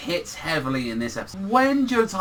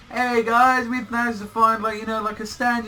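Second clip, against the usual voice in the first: higher-pitched imitation of an upbeat greeting; mock chirpy greeting; 8 semitones higher, 3 dB louder than usual. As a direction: voice them eagerly hailing the gang. Voicing characters casually greeting friends.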